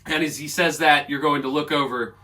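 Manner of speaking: normal talking voice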